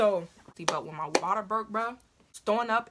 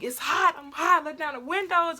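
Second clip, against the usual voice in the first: high, louder mock holler; retold screaming; 8 semitones higher, 4 dB louder than usual. Hot in the car during labor, high retold yelling. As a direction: holler it, acting out distress. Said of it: "I was screaming".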